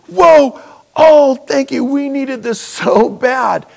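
high, loud gush of relief; grateful outburst. Retold or imitated. Preacher reliving thanks for the church check, grateful outburst. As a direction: exclaim thanks, drawing out the need.

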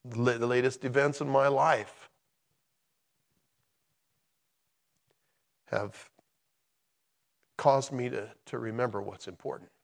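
halting, hushed, with long pauses; emotional. Real, not acted. Preacher sharing recent events in his life, emotional. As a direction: speak softly and haltingly, holding back feeling. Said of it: "I get all emotional about this stuff".

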